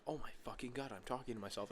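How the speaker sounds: plain inner voice, quiet; muttered realization. Retold or imitated. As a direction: mutter it to yourself. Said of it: "I'm like".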